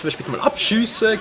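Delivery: ordinary talk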